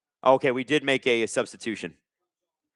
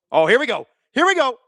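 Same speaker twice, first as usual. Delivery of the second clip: exasperated, jumping much higher on the repeat; rising exasperation; 10 semitones higher, 4 dB louder than usual. Real, not acted. Streamer sees a foul called, rising exasperation. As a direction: protest building to a high cry. Protesting a referee's call.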